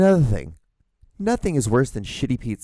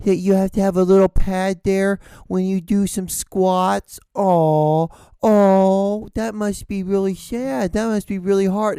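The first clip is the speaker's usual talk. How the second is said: whiny, drawn-out fake pity, pitched higher; mock sympathy; 5 semitones higher, slower than usual. Comedian mocking a person who needs a squat pad, fake pity. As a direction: coo with exaggerated, sarcastic concern.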